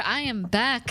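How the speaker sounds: ordinary talk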